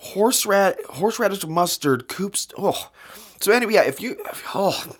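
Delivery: groaning, the taste still burning; real disgust, words breaking off; after bitter medicine. Real, not acted. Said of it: "can't get the taste out of my mouth".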